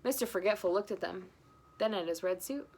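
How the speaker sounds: ordinary delivery, nothing marked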